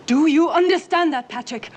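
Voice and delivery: high, very loud, every word driven home; full-voiced motherly fury; angry shouted demand. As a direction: yell it at the child for an answer. Mother repeats demand, louder.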